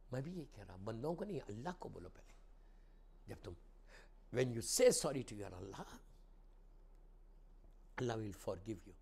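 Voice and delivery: ordinary talk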